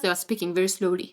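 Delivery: ordinary delivery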